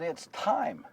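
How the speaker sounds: very soft, grave delivery; hushed announcement. Acted. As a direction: break momentous news quietly. Mary announces labour has begun.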